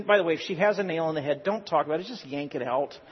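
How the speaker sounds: usual speaking voice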